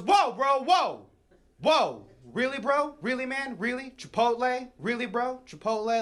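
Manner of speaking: high-pitched, disbelieving objection; incredulous brother. Acted. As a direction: stop them in their tracks, appalled. Brother appalled by Chipotle request.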